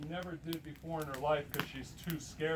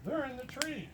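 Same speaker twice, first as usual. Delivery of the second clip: high-pitched imitated realisation; simultaneous exclamation; 8 semitones higher than usual. Mike and Steve realising at the same time, high exclamation. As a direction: pitch it well up, as two people blurting together. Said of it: "Mike and Steve at the same time".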